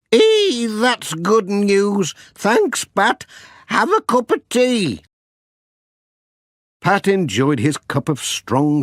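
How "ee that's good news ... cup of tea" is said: high, delighted granny, slow on the good news; a pleased old grandmother, drawn out. Acted.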